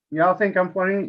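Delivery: ordinary delivery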